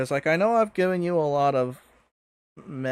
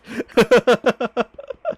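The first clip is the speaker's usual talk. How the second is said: giggling; 7 semitones higher, 7 dB louder than usual. Real, not acted. Laughs at own dirty mind.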